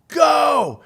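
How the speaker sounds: high, loud command to go; retold full-voiced starting shout; shouted signal. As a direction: call it out across the water. Herbie's signal to paddle.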